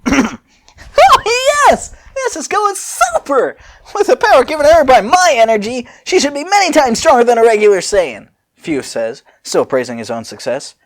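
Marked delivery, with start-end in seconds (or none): 0.1-8.3 squeaky high musing inventor; high Fu pondering aloud. Acted. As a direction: go way up high and play the giddy scientist. Fu voiced in the story.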